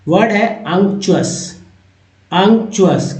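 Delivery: unctuous said slowly as unk-chu-us; slow model word. Shown as a demonstration. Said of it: "क्या word है".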